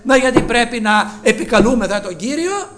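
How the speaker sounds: ordinary talk, nothing marked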